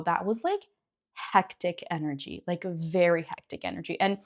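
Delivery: normal talking voice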